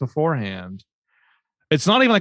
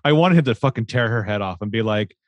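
ordinary talk

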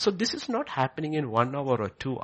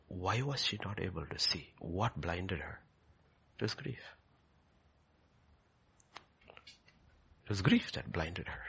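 hushed questions; 6 semitones lower, 11 dB quieter than usual. Real, not acted. Hushed questions about grief.

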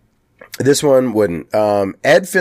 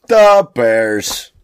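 Chicago superfan catchphrase "Da Bears"; Chicago accent; 3 dB louder than usual. Retold or imitated. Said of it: "Chicago has this accent".

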